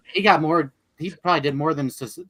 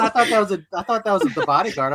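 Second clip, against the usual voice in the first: ordinary talk, nothing marked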